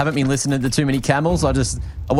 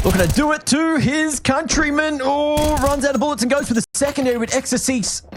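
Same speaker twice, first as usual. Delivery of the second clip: much higher hype call; excited commentary; 12 semitones higher than usual. Real, not acted. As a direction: ride the clutch with rising energy. Caster hyped during clutch.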